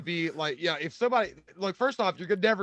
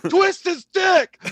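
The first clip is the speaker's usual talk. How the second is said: very high, stretched-out heckler yell; drunken yelling; 12 semitones higher, 6 dB louder, much slower than usual. Retold or imitated. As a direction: holler it slowly like a drunk fight fan. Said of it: "He's yelling at the octagon".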